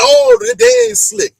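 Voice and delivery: high, loud mock exclaim; excited exclaim. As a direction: call out the producers gleefully. Exclaiming at the producers' zoom.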